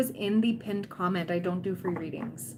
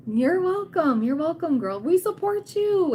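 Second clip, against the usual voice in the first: higher and louder, gushing support; excited cheering; 8 semitones higher, 5 dB louder than usual. Real, not acted. Streamer cheering on her viewers, excited and higher. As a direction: hype up your viewers.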